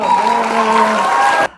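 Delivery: loud, high cheerful shout. Real, not acted. Teacher cheering students for finishing hiragana. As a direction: celebrate the win with a shout. Celebrates learning the whole hiragana.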